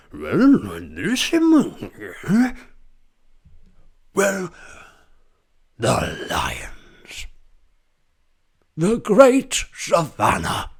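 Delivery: slow, high, swooping nature narrator; dance around the scale like a documentary; Attenborough impression. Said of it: "David Attenborough impression".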